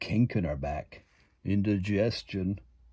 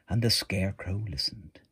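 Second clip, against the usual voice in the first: ordinary delivery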